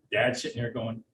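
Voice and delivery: ordinary talk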